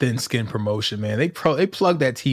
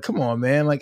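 ordinary delivery

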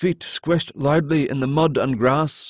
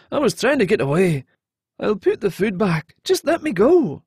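higher-pitched, pleading man; frightened captive; 6 semitones higher than usual. Acted. Caught man begging the hero to release him, pleading. Narrator voices frightened Alton.

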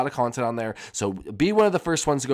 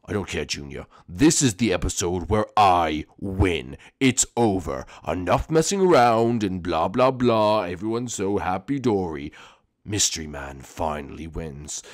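much lower, slow, menacing villain drawl; low sinister villain; 7 semitones lower, slower than usual. Acted. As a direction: purr it out like a creepy mastermind. Villain gloating.

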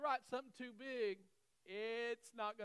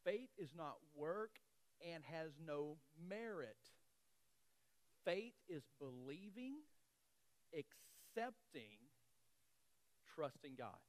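slow emphatic reading; 5 semitones lower, 4 dB quieter, slower than usual. Shown as a demonstration. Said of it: "grab this".

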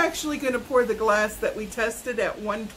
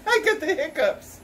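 laughing; 7 semitones higher than usual. Real, not acted. Laughs about sudden hiccups.

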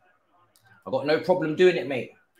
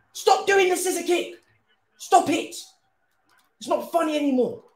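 very high, frustrated yell; real high-pitched gamer complaint; exasperated scolding. Real, not acted. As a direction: plead angrily with players to stop. Scolding players over scissor kicks.

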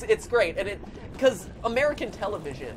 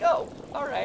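mild granny shrug; 8 semitones higher than usual. Retold or imitated.

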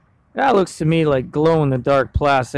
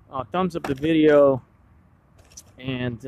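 ordinary talk, nothing marked

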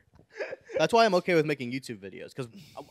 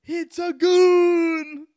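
whale impression; 8 dB louder, much slower than usual.

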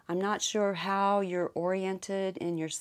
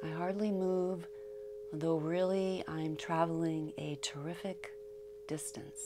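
calm verse, fading softer towards the end; meditative poem reading; 3 semitones higher, 7 dB quieter than usual. Acted. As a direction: read the poem softly, letting each phrase rest.

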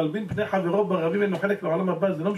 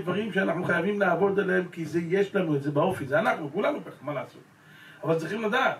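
ordinary talk, nothing marked

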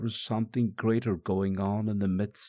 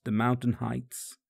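plain reading voice

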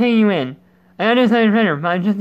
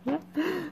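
giggle; 9 semitones higher, 13 dB quieter than usual. Real, not acted. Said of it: "Sorry, I had to".

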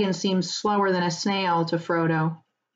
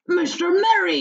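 much higher surprised farmer; astonished cry; 11 semitones higher, 4 dB louder than usual. Acted. Farmer recognizes Merry, astonished cry. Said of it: "he cried".

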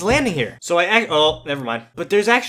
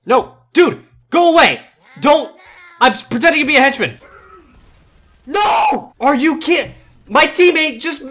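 loud, high panicked protest; frustrated yelling; 7 semitones higher, 5 dB louder than usual. Real, not acted. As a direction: yell at a clumsy teammate. Disguise ruined, yells at teammate.

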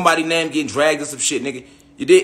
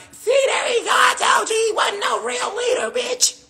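mocking high shout; slower than usual. Vlogger imitating critics who gloat when he responds, in a shrill high shout. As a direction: shriek it like a gleeful hater. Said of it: "here they go like".

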